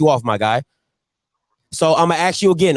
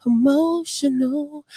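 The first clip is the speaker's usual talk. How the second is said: drawn-out mock; 6 semitones higher, 6 dB quieter, much slower than usual. Mocking the caller's word.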